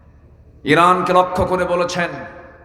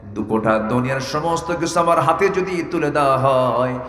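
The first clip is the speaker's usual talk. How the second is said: melodic sermon storytelling; 4 semitones lower than usual. A narrator's reading. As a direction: intone the tale in long sustained notes.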